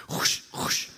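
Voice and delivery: voiceless swishing brush noises; two rushing mouth-made whooshes; imitated footsteps.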